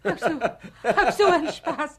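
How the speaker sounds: relieved laugh. Acted. Relieved after shocked question.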